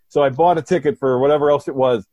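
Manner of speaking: normal talking voice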